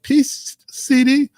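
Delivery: a playful high take on his wife's greeting; imitating wife. Host mimics his wife greeting him in chat, high mock voice. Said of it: "she was like".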